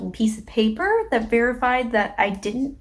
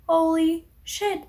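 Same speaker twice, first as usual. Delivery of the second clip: slow, higher-pitched retold astonishment; stunned amazement; 6 semitones higher, slower than usual. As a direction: draw it out in mild awe. Retold amazement after stranger helps.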